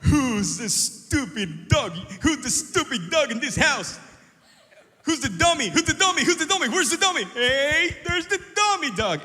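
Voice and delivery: loud teasing singsong to a dog; mock-scold the dog in a high playful voice; perro, voz juguetona y fuerte; talking to the dog. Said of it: "Llega a casa y diga".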